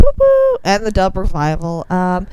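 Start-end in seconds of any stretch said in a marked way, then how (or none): 0.0-0.6 cheer. Acted. Cheering for bands.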